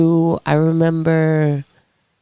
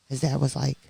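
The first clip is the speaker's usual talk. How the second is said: normal talking voice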